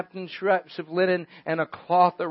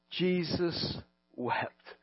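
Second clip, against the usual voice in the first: Jesus wept, very slow, much softer; slow hushed; 10 dB quieter, much slower than usual. Shown as a demonstration. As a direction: linger on each word, barely there.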